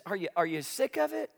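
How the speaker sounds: ordinary talk, nothing marked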